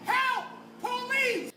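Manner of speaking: high, loud yell; mock cry for help. Storyteller reacting to an unreported case, mock yell for help. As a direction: call out for the police in mock alarm.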